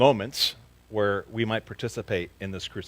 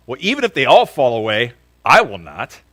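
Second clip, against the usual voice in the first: loud, bold protest; Peter protesting; 12 dB louder than usual. A narrator's reading. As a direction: Peter insists loudly he won't fall away. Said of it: "And Peter said to him".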